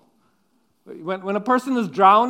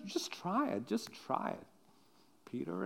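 soft coaxing; 14 dB quieter than usual. Retold or imitated. Jesus voiced coaxing Peter.

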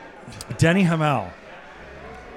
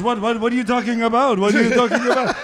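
higher, protesting what are you talking about; mock defensive denial; 5 semitones higher, 3 dB louder than usual. Teammate denying the jab, mock protest. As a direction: play innocent. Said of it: "he goes".

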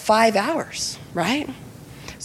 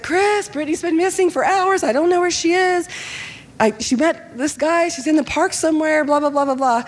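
frantic; 6 semitones higher than usual. Retold or imitated. Frantic mom calling pastor friend.

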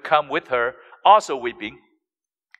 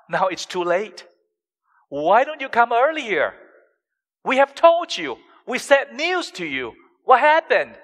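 higher, pleading, accusing complaint; frustrated reproach; 5 semitones higher than usual. Retold or imitated. Mary reproaching Jesus for coming too late, frustrated. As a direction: press him like someone who came too late. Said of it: "we are as frustrated as Mary".

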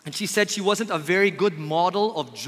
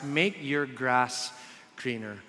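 lower, softer, very slow greener; slow punchline; 5 semitones lower, 5 dB quieter, slower than usual. Acted. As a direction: land the maxim gently. Punchline of the maxim.

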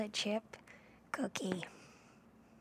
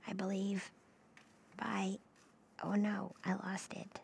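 soft whispered musing; ASMR whisper; 4 dB quieter than usual. Acted. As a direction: whisper as if to a sleepy listener. Whispers while recalling song.

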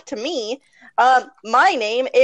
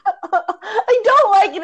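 laughing; 11 semitones higher, 3 dB louder, much slower than usual. Real, not acted. Laughing, embarrassed about herself.

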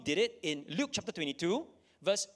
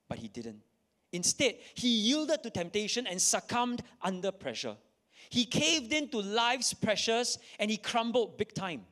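ordinary delivery

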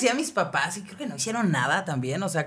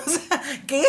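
laughing; 7 semitones higher than usual. Real, not acted. Laughing at his gaslighting.

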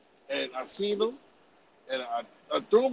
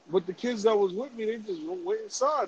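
ordinary talk, nothing marked

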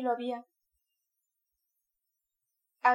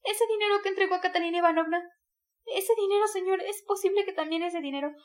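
Sonia's higher voice, a sudden alarmed cry; sudden exclamation; 7 semitones higher, 4 dB louder than usual. A narrator's reading. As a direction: Sonia suddenly seizing on the money. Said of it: "exclamó de súbito". (Sonia exclama de súbito)

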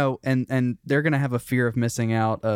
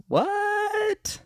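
very high shocked what; stunned what. Retold or imitated. The friend-zoned guy reacts on TV, very high and stunned. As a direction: squeak it out, stunned.

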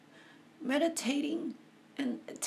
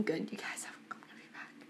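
whisper; 2 semitones lower, 13 dB quieter, much faster than usual. Real, not acted. Composing herself after crying.

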